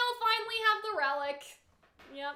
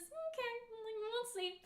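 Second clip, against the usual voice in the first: very soft, pitched-up doubtful musing; quiet skepticism; 4 semitones higher, 13 dB quieter than usual. Retold or imitated. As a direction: murmur it lightly, reserving judgment. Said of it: "the tone of the".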